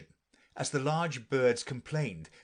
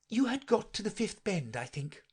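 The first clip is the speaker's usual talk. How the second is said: put-on high, tentative girl's voice; humble; 9 semitones higher than usual. A narrator's reading. Alice answering the offended mouse, humble. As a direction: offer it humbly, dropping away at the end. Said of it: "said Alice very humbly".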